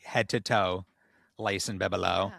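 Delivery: normal talking voice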